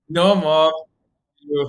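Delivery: calling out to mom. Real, not acted. Son calling out to his watching mom, loud and playful. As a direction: protest loudly, half joking.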